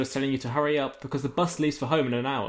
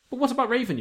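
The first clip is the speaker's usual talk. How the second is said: anxious question; 10 semitones higher, faster than usual. Acted. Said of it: "you ask".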